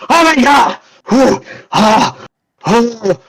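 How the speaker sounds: high startled exclamation and gasps; a mock startled gasp; shocked cry. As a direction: jump at finding a stranger. Duchess shocked at stranger.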